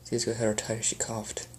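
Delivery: ordinary delivery, nothing marked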